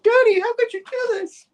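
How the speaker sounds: very high, loud mock wail; distraught daughter. Daughter to Daddy after being slammed down, high mock wail. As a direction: squeal it as a hammed-up daughter. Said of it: "she's like looking at him".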